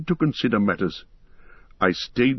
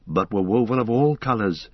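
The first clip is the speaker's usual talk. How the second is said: ordinary delivery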